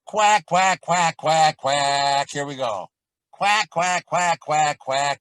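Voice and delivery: loud nasal-free duck quacks. Shown as a demonstration. Teacher leading the quack exercise, loud duck quacks. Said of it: "let's quack".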